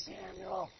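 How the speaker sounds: soft whisper of a name; the Lord whispering the boy's name. Acted. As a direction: breathe the name out. The Lord calls a third time.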